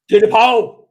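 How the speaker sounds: loud, high shout at the viewers; mock-bossy loud call; emphatic shout. Real, not acted. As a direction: belt it out to the chat.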